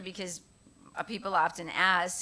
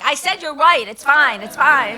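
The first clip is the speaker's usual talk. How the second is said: much louder, exasperated; loud insistence; 6 semitones higher, 16 dB louder than usual. Retold or imitated. Director loudly insisting she was right. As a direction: bark it to end the argument. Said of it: "And he's like".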